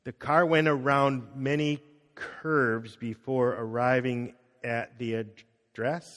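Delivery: slowly, each word drawn out; slow careful storytelling. Shown as a demonstration. Student retelling the sign-language story slowly. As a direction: tell the story slowly and steadily.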